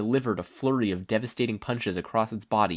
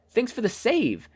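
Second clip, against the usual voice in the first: thankful heroine; 12 semitones higher, 3 dB louder than usual. A narrator's reading. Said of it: "Kathleen complimented".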